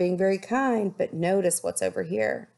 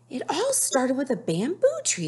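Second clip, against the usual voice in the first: higher, eager storytelling voice; Amabel; 8 semitones higher than usual. Acted. Amabel beginning her story to Nettie, higher and eager. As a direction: start the tale brightly. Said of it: "said Amabel".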